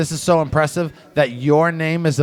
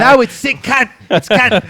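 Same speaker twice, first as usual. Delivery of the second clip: Australian accent; 7 semitones higher, 3 dB louder than usual. Shown as a demonstration. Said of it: "your Australian".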